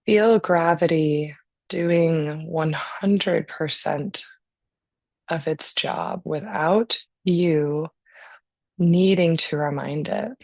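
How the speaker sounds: calm, unhurried breathing guidance; guided meditation. Real, not acted.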